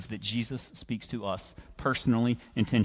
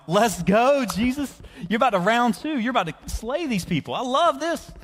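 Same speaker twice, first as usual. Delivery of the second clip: fired up; 9 semitones higher than usual. Acted. Simon urging Jesus into round two, fired up. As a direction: cheer him on like a thrilled fan. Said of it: "I'm getting fired up".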